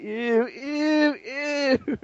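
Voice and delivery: squealing disgust, pitch up; grossed-out Optimus. Retold or imitated. Friend mocking Optimus, grossed out, squealing ew. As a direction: squeal ew like a robot touching gore. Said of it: "he, he's like".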